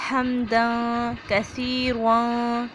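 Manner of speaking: हमदन कसीरन very slow, the न hidden in the nose; nasal ikhfa. Shown as a demonstration. Teacher models हमदन कसीरन slowly, nasal ikhfa. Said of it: "इख्फा करेंगे, नाक में आवाज़ को छुपाएंगे".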